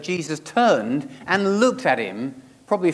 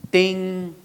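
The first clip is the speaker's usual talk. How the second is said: high, sustained "ding"; imitated bell ding; 5 semitones higher than usual. Preacher marks the first target falling, imitated bell ding. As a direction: sound the bell on one ringing note.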